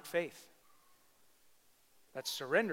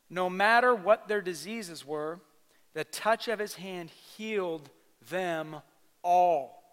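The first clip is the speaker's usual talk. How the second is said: "all" hit loud and slow; slow emphatic preaching; 4 semitones higher, 9 dB louder, slower than usual. Shown as a demonstration. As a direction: drag it out, then land "all." hard. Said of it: "How many? All".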